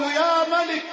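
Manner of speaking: slow, loud drawn-out cry of يا ملك; a crippled man crying out to God at the Kaaba; shouted plea. Retold or imitated. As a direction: call out يا ملك with all your strength. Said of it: "فظللت أصرخ وأقول".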